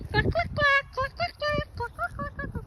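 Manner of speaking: high, playful clucks in quick bursts; chicken clucking imitation.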